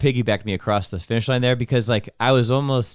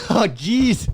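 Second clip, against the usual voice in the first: put-on Irish old lady voice; 10 semitones higher than usual. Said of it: "echoes the Irish granny voice".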